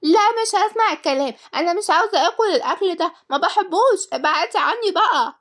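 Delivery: put-on very high-pitched child voice; child tantrum, squealed high; stubborn little girl. A narrator's reading. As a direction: refuse everything like a bratty child. Child's tantrum over food.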